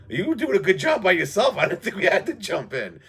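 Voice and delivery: high and loud, teasing drummer reply; smug drummer. Retold or imitated. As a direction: toss it back with a dry grin. Drummer's reply, voiced in story.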